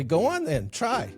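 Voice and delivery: lightly teasing invitation, lifting on the last word; playful dare. Retold or imitated. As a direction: playfully egg someone on. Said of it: "they would playfully say".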